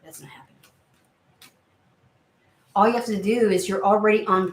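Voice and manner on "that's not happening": voiceless whisper; whispered aside. Real, not acted. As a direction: breathe it as a private refusal. Muttered aside refusal.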